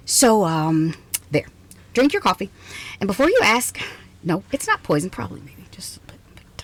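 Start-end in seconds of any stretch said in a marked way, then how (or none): 5.1-6.6 teasing whisper. Acted. Scientist teasing her trapped guest about the coffee, sly whisper. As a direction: drop to a sly whisper after the joke.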